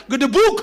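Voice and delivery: loud, heavy "gedebuk!"; sudden crashing thump word; imitated thud. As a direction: boom out the sound of a fall. Imitates child falling.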